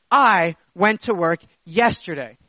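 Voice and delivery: long "I", each phrase set apart; stress on I. Shown as a demonstration. Preacher showing not you or someone else, stressed "I". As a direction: hold "I", then space out the rest. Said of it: "further emphasis upon the I".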